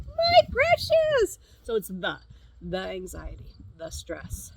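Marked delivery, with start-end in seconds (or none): 0.1-1.4 high, loud and slow, clutching a treasure; greedy creature voice. Retold or imitated. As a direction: croon it like a creature hoarding a ring. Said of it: "your mind is like".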